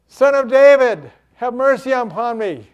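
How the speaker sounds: high, loud cry for mercy; shouted plea, strongest at the start; desperate shout. Acted. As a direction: cry out over a noisy crowd. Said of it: "He shouted out the more".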